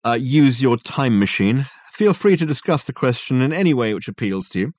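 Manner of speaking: high, loud, hesitant question; calling Dirk figure. A narrator's reading. Dirk asking Reg about the time machine, calling out.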